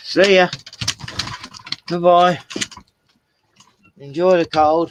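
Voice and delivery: high, loud mock-cheery goodbye; sing-song taunt to the dog outside; teasing farewell. Real, not acted. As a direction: wave the dog off sarcastically.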